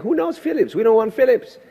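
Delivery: high, incredulous mock protest; dismissive retort. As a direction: scoff at the unknown newcomer. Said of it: "I said".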